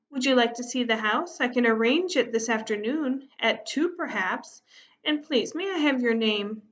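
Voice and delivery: ordinary delivery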